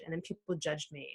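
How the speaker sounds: ordinary talk, nothing marked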